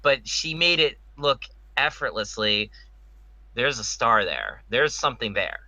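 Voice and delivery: normal talking voice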